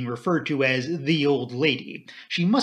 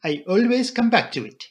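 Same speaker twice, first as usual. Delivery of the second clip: put-on higher, serious, French-accented voice; Poirot, serious; 8 semitones higher, 4 dB louder than usual. A narrator's reading. Poirot answers the doctor, seriously. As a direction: insist on it seriously, foreign accent. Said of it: "he said seriously".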